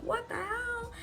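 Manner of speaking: very high mock gasp; gasping shock. Gasping guests as the bride runs out, mock shock. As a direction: squeak it out in stunned disbelief. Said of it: "gasping. They're like".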